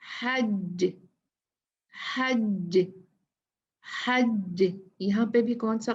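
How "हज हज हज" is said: हज with the ज stopped and bounced; stop with echo. Shown as a demonstration. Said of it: "रुकना है".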